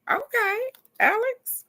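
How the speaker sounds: very high, delighted call; excited squeal. Real, not acted. Thrilled at the viewer's card, excited squeal. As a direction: squeal the name happily.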